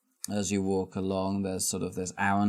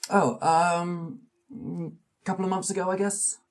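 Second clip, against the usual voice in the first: put-on small old man voice; 7 semitones higher than usual. GM voices Charles Adams.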